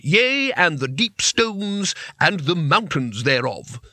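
slow, archaic grand pronouncement; lightly voiced pompous seer; lofty wizard boast. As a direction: intone it like scripture.

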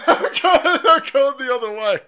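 high, loud laughing words. Real, not acted. Imagining fleeing the school, laughing loudly. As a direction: laugh it out, backing off.

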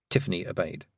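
ordinary delivery

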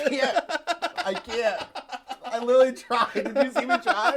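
high, laughing and pleading; laughing protest. Real, not acted.

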